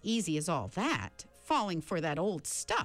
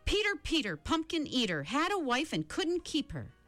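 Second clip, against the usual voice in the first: shouted rhyme; 9 semitones higher than usual. Acted. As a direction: shout the rhyme to block the chant. Shouting rhyme against chant.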